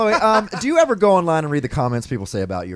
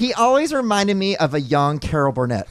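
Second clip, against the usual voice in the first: online troll; 6 semitones higher, 4 dB louder than usual. Acted. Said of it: "in the way we think the online troll would sound".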